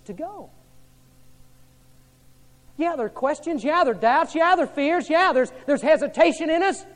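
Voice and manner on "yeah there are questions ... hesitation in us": building preaching. Real, not acted.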